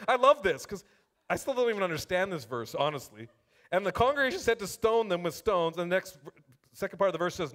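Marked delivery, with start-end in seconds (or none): 3.7-5.8 raised, ringing Bible reading to the room; scripture reading. Acted. Preacher reading the spies' report aloud to the church, proclaiming. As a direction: proclaim the verse to the congregation.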